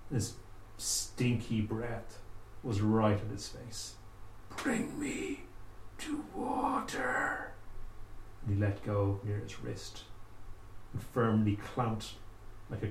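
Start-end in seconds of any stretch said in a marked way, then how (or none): 4.6-7.5 high rasp sinking into a slow whisper; raspy corpse plea. Acted. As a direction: start high and cracked, then breathe the rest out. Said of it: "in this raspy, horrible voice".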